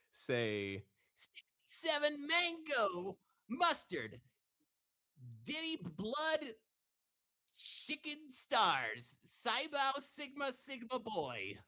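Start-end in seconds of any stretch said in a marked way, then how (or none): 1.2-11.6 much higher, slow cartoon hedgehog voice; Sonic. Shown as a demonstration. Guest reads a chat request in Sonic's voice. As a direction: stretch out every word high like Sonic. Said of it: "first in Sonic's voice".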